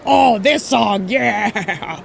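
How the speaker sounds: slow, loud excited recognition; delighted recognition of the song. Retold or imitated. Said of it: "I was like".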